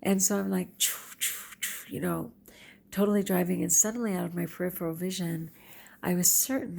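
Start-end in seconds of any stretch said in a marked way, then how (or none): 0.8-1.9 soft breathy "choo, choo, choo"; whooshing car noise. Retold or imitated. Driver telling how she sped along the empty road, imitated whooshing. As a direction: imitate a car zipping past in quick whooshes.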